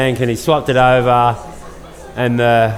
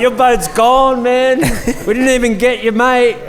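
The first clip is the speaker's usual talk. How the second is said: mock outburst breaking the bad news; worked-up retelling; 11 semitones higher than usual. Retold telling owner boat is lost.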